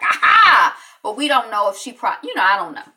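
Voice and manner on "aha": high, loud "Aha!"; gotcha reaction. Acted. Viewers catching it on video, triumphant gotcha. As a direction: pounce on it in mock triumph.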